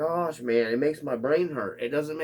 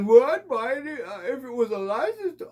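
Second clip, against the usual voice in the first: high, louder mock voice; mocking a picky buyer; 12 semitones higher, 6 dB louder than usual. Mocking fans who only buy licensed bodies, high. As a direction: pitch it up like a fussy fan. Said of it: "Then you got guys".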